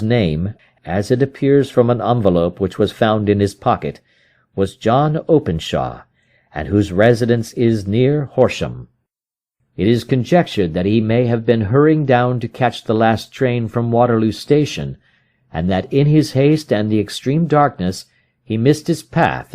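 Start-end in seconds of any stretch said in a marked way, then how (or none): none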